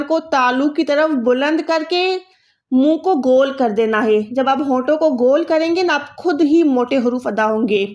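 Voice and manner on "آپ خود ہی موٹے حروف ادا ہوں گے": ordinary delivery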